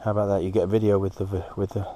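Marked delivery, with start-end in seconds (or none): none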